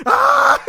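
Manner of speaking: loud high mock scream. Coworker screaming at it, loud high mock scream. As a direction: shriek at it in jest. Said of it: "he starts screaming at it".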